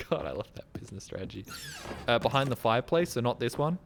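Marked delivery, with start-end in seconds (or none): 0.1-1.4 sarcastic whisper. Real, not acted. Sarcastic aside on game.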